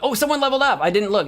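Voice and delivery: ordinary talk, nothing marked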